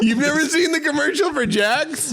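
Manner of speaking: can't believe they missed it; incredulous. Real, not acted. Incredulous at friend's ignorance.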